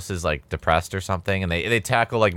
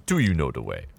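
Ugandan Knuckles meme line; 6 semitones lower, 3 dB quieter than usual. Retold or imitated. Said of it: "should have been like".

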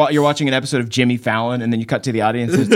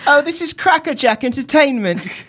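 put-on high, plummy British kid voice; British child; 12 semitones higher than usual. British child applauding the hosts' bit, high-pitched posh delight. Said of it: "what to say in British".

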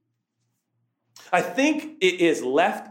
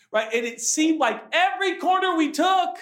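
ordinary delivery

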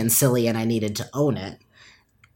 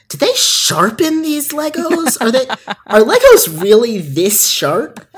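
high, incredulous squeal of surprise; shocked disbelief; 11 semitones higher, 7 dB louder than usual. Retold or imitated. Friend touching the Lego paddle, high shocked disbelief. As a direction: gasp it out as if the bricks cut you. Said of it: "they went".

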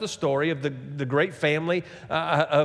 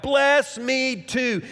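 high, slow, drawn-out plea; desperate preacher-acted entreaty; begging; 10 semitones higher, much slower than usual. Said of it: "he begged".

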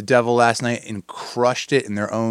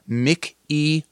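normal talking voice

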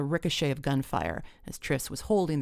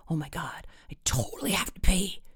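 whispered complaint to a friend; hushed but emphatic; urgent whisper; 2 semitones higher than usual. A narrator's reading. Hidden friend whispers urgent need.

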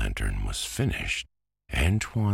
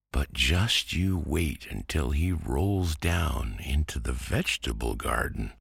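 usual speaking voice

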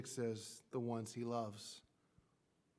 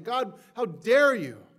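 slow, loud, much higher defiant shout; a believer crying out in anger, how dare you; angry cry at God; 9 semitones higher, 16 dB louder, slower than usual. Pretend. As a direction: shake a fist and shout it at heaven.

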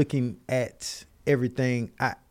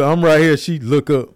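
ordinary talk

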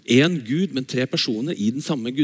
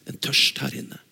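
whisper; 3 semitones lower, 5 dB quieter than usual. Real, not acted. Intimate close of a sermon.

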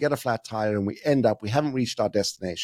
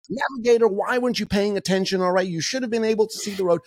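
hammed-up blaming parent; 8 semitones higher than usual. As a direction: scold everyone like an indignant parent. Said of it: "They start blaming and accusing".

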